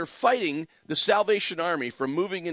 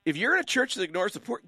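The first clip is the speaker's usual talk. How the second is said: ordinary delivery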